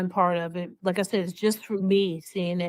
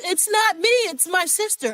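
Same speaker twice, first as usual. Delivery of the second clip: mimicking the governor; 8 semitones higher than usual. Retelling the governor shifting blame, in a high mock voice. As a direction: squeak it out like a sly denial. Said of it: "And then she says".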